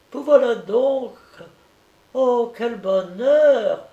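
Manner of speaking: put-on high, loud little-boy delight; delighted child. A narrator's reading. Sick boy welcomes his visitor at his bedside, delighted. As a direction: exclaim joyfully in a high child's voice, drawing out the end.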